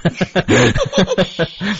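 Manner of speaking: bright burst of laughter at a cheeky question; real laugh. Real, not acted. Host asks about the English, guest bursts out laughing.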